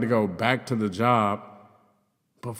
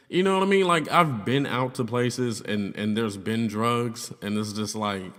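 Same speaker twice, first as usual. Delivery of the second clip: ordinary delivery, nothing marked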